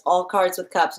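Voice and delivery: ordinary delivery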